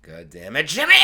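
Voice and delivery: curse, then bellow the name; exasperated yell. Retold or imitated. Howard curses Jimmy's antics, exasperated yell.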